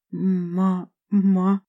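stuck on the first syllable; stammering. A narrator's reading. Frightened servant failing to name the teacher, stammering. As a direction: stammer out a broken start. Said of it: "начал заикаться Закрос".

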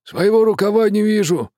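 a touch of gloomy grumbling; gloomy grumble. A narrator's reading. Steersman grumbling to the captain, lightly gloomy. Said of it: "мрачно проворчал рулевой".

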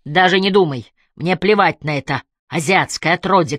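plain reading voice

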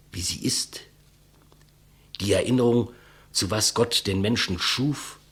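grave, measured speech to his killer; solemn declamation. Acted. Condemned man addressing his executioner, solemn and measured. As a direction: speak like a last testament.

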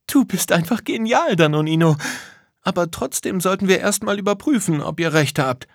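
high, admiring character voice; Lisa, impressed. Acted. Lisa praises Danonino then urges checking, high and impressed. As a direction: exclaim in a high voice, then caution.

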